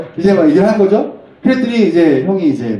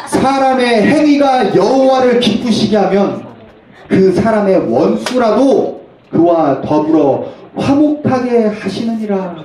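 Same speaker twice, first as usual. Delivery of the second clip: slow, high, declaimed verse; scripture reading; 6 semitones higher, slower than usual. Acted. Pastor reading Proverbs to the congregation, solemn proclamation. Reads the Bible verse aloud.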